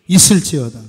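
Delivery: loud, falling shout; shouted proclamation. Acted. Pastor shouting the blessing over the church, loud. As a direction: ring it out to the room.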